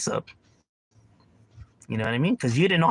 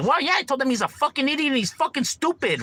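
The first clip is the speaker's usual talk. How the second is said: high mock justification; smug critic; 11 semitones higher than usual. A guy excusing insulting his friend, mock high voice.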